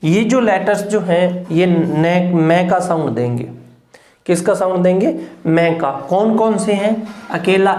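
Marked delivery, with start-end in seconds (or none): none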